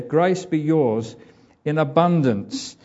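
slow, weighty scripture quote; slow emphatic reading. Shown as a demonstration. Preacher rereading the blessing slowly and emphatically. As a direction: savour each word slowly. Reads verse again emphatically.

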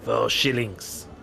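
put-on lightly French-accented gambler, polite and amused; a suave foreign card sharp offering a game; Bretonnian accent. Gambler invites the players to deal, light Bretonnian accent.

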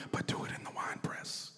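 whispered aside, no voice at all. Retold or imitated. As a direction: breathe it like a secret add-on to the prayer.

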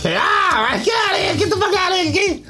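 high shouted scolding, pushing him out; mock yell. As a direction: yell like a big sister who caught you snooping. Sister yelling at kid intruder.

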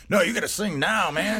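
high-pitched, urgent cast-mate voice; mock insistent demand. Interviewer playing cast telling actor to sing, mock insistent. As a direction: push it high, playfully bossy.

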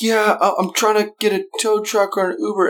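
high, strained imitation of panic; mock freak-out in a high voice; frantic. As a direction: play a flustered caller after a crash. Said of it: "frantic, freaking out".

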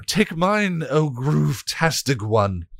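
high, slow eager offer; fawning minion. Acted. Minion offering its brain to the god, fawning. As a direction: grovel as you offer yourself. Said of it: "the first minion offered".

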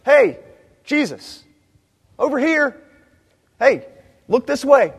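a friendly, offhand call for attention; casual call-out. Retold or imitated. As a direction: flag someone down across a crowd, lightly. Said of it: "This isn't the kind that's like".

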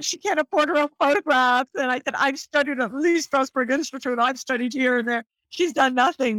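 mock bragging. Bragging about her fake twin.

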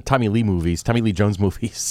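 ordinary delivery

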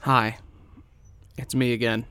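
normal talking voice

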